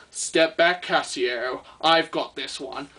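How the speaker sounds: low, slow, smug hero voice; mock hero taking over, low and slow; cocky watch man. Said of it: "pops out the shadows and goes".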